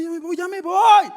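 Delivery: wife fleeing the men's hall, frightened; scared wife. Retold or imitated. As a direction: hurry out in a fluster. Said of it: "mi esposa sale asustada y dice".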